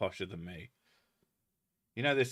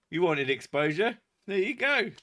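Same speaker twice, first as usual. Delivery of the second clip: mock taunt; 6 semitones higher, 4 dB louder than usual.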